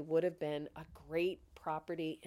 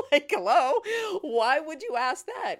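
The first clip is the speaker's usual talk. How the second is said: high, loud, incredulous laughing comeback; amused and astonished, voice shooting up; laughing disbelief; 9 semitones higher, 11 dB louder than usual. Retold or imitated. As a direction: laugh while demanding an explanation. Mock incredulous comeback in retold exchange.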